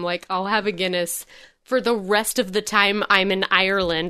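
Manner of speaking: normal talking voice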